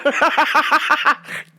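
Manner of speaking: high giggle at own joke; laughing. Real, not acted. Laughing at her own Wakanda joke, high giggle. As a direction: break into a giggle.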